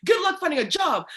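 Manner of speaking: high, loud, fast mock lecture; threatening teacher. Teacher threatening a student who talked back, loud high mockery. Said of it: "they're like".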